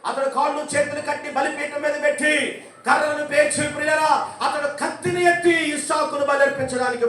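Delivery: high, loud storytelling sermon; fervent preaching. Real, not acted. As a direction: drive the story home to the congregation. Raised preaching of the Bible story. (ఉత్సాహంగా ఎత్తైన స్వరంలో బోధన)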